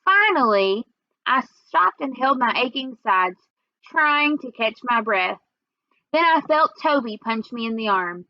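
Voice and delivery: ordinary delivery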